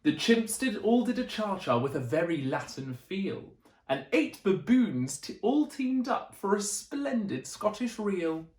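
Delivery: plain reading voice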